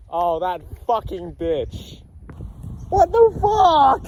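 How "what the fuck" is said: high, loud, slow disbelieving cry; shocked shout. Real, not acted. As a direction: shriek it in disbelief. Sees the prank spread everywhere.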